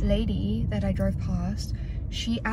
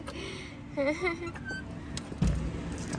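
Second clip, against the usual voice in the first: soft laugh trailing the goodbye; laughter; 8 semitones higher, 8 dB quieter than usual. Real, not acted. After saying goodbye to friends, soft laughter. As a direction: let a quiet laugh tail off.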